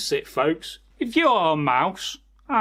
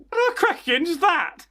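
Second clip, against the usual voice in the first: much higher, startled little mouse; a tiny mouse squeaking in alarm; high-pitched cartoon mouse, surprised; squeaky mouse voice; 11 semitones higher than usual. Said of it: "the mouse said".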